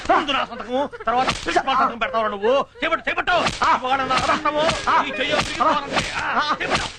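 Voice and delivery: high-pitched furious orders; angry scolding. Acted. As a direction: order the hand out between blows. Teacher canes pupil's hand. (కోపంగా కొడుతూ గద్దింపు)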